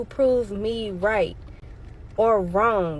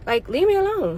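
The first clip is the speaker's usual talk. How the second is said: exasperated plea; 7 semitones higher, faster than usual. Real, not acted.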